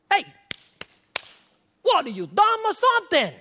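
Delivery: loud, high, incredulous mock scolding. Jesus jeering at the clueless disciples, loud and mocking. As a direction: jeer at them like they're dense.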